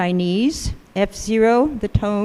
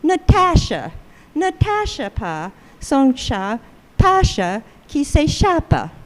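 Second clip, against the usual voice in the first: English speaker's French; 4 semitones higher than usual. Retold or imitated. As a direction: imitate an English learner carrying over English stress into French. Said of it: "an English speaker saying the same sentence".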